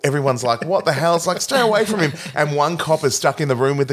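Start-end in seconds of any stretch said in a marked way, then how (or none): 0.7-2.1 higher-pitched retold panic; mock alarm. Cops react to the infected man, mock alarm. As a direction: imitate cops backing off in alarm.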